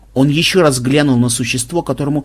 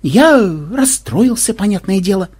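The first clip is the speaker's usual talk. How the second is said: aggrieved; 7 semitones higher than usual. A narrator's reading. Ксен explains his reaction to the coffin raid, aggrieved. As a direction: stretch it out with injured dignity.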